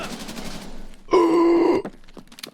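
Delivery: loud high startled gasp; sharp gasp. Real, not acted. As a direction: gasp in sudden fright. Ambushed by too many scavs.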